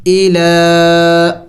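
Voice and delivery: ایلا said slowly, the یا left silent; teacher sounding out ایلا; slow demo of ایلا. Shown as a demonstration. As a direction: draw out ایلا without the یا sound. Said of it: "ہم نے یا کو نہیں پڑھنا ہوگا".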